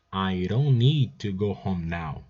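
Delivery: slowed down, each word laid out; slow model repeat. Shown as a demonstration. Teacher repeats the sentence slowly for students. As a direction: say it slowly so students can copy.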